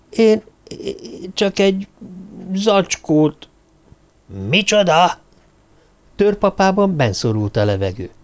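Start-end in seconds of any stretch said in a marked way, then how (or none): 0.1-3.5 high, halting confession with long pauses; nervous faltering. A narrator's reading. Alex confesses haltingly when challenged. 4.5-5.2 higher-pitched startled question; incredulous. A narrator's reading. Törpapa stunned by Alex's admission.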